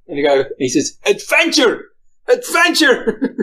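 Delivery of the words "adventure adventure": high, drawn-out retold cry; dramatic proclamation. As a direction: imitate the pilot proclaiming it, stretching the repeat. Acting out pilot's answer.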